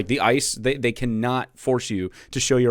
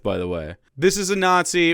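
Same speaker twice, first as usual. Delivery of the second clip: ordinary talk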